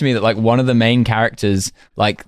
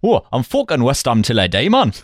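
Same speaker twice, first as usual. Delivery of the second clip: mocking, badly botched East End accent; hammed-up bad Cockney accent impression; butchered West Ham accent; 3 semitones higher than usual. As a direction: mangle a London football hooligan accent for laughs. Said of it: "utterly butcher the accent".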